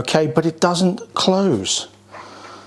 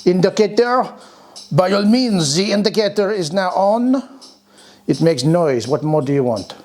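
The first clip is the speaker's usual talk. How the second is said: hammed-up, wobbly French accent; comic mock-French accent; muddled French accent; 4 semitones higher than usual. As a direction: put on a bad French accent that drifts. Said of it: "Don't know what that accent was".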